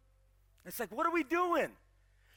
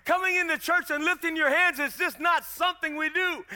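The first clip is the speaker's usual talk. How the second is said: loud preaching, rising in pitch and force; 4 semitones higher, 7 dB louder than usual. Real, not acted. Preacher telling church of Moses' raised hands, building full-force preaching. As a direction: build to a loud, high sermon climax.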